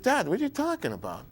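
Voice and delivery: high, disbelieving "Dad, what are you talking about?"; retelling a stunned reply to his father; shocked question. Retold or imitated.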